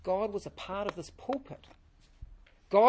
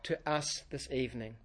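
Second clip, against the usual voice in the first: prayer; 4 semitones lower than usual. Real, not acted. Pastor leads opening prayer.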